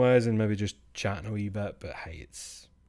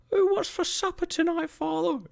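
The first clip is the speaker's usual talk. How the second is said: put-on very high falsetto, louder, like a comic old-fashioned child; made-up character voice; 6 dB louder than usual. The streamer acting out a made-up family asking about supper, in a high falsetto. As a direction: squeak it out in a silly high put-on voice. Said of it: "those people that I just made up".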